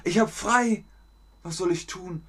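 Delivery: high-pitched, helpless put-on voice; acted post-stress letdown. Teacher acting someone lost on a day off, high helpless voice. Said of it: "Hast du die Entlastungsdepression?".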